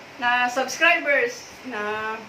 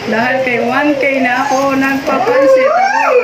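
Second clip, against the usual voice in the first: jubilant shout; 11 dB louder than usual. Real, not acted. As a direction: shout the celebration out, rising to a squeal. Shouting the celebration. (malakas na sigaw sa tuwa)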